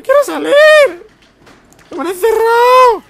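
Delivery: very high, loud panicked shriek. Pretend. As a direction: scream to be let out. Trapped in elevator, yelling.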